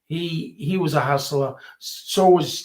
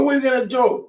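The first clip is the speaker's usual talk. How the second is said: higher and louder, a retold panicky plea; asking the doctor what happens now, alarmed and pleading; alarmed question; 10 semitones higher, 5 dB louder, faster than usual. High, anxious plea.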